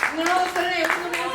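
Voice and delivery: normal talking voice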